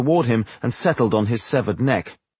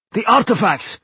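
high, urgent stern call; sharp high reprimand; stern reminder; 10 semitones higher, 3 dB louder than usual. A narrator's reading. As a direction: sternly remind him, pitched well up. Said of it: "reminded him sternly".

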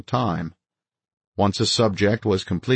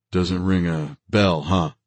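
offhand. A narrator's reading. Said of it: "Hu said in an offhand tone".

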